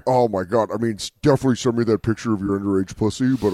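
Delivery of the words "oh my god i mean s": usual speaking voice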